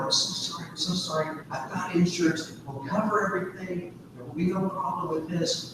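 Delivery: quiet, rushed apology, owning the fault; hushed sorry-sorry to an angry driver; softly pleading, mildly imitating the wife; soft apologetic pleading. Wife apologising after rear-end crash.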